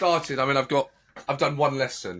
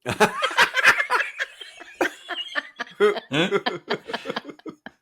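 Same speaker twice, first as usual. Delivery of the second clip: high, drawn-out laughter; long laugh; 8 semitones higher than usual. Real, not acted. Laughing after the Italian restaurant story, helpless. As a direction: fall about laughing.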